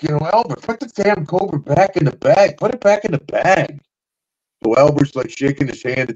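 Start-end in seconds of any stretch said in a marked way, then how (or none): 0.3-3.8 higher, urgent plea; imitating scared wrestlers; nervous pleading. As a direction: beg him to bag it. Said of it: "everybody's like".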